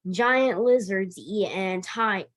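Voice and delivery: ordinary delivery, nothing marked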